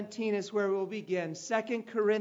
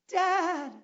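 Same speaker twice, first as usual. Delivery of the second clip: put-on high child voice calling from afar; distant boys calling for their dad; faint call; 8 semitones higher, 3 dB louder than usual. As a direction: sound like boys calling from across a field. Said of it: "real faint".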